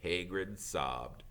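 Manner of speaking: ordinary delivery, nothing marked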